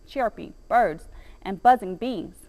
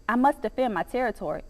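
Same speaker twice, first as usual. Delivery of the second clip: plain reading voice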